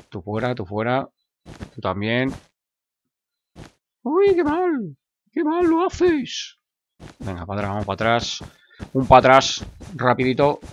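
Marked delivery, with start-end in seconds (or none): 4.0-6.6 high falsetto wail, drawn out at the end; comic high-pitched tut-tutting; mock scolding. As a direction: scold in a silly squeaky voice.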